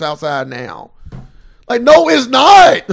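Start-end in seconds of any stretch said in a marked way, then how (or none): 1.7-2.8 slow, loud, high flat-out no; loud, slow no, it's not; emphatic denial. Real, not acted. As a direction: slam the door on the excuse. Rejecting the mothers' excuse.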